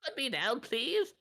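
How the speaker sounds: high-pitched begging chicken voice; pleading chicken. Acted. Chicken begging its keeper to put it down, high plea.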